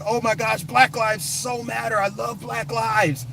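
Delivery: high, drawn-out fake adoration; mock gushing. Mimicking hypocrites professing love.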